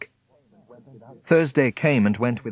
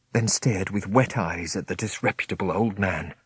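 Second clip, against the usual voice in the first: ordinary delivery, nothing marked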